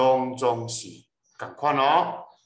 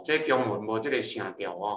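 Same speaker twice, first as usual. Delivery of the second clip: ordinary delivery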